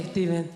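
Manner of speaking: Steven said with the S dropped, like tiven; mum's version of Steven, no clean S; Spanish-accented Steven. Retold or imitated. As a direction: Steven mispronounced, Spanish style.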